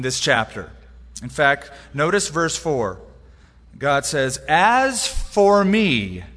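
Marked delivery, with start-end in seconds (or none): 4.5-6.2 "As for me" said very slowly, each word weighted; emphatic as for me. Shown as a demonstration. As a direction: lean hard on "As for me", drawn out. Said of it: "an emphatic self-reference".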